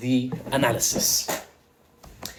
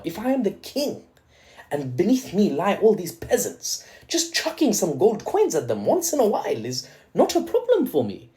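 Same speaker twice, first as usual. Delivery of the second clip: lightly voiced lofty king, high; a king tossing coins to peasants, grandly; lordly king; 6 semitones higher than usual. Speaker imagines being a king.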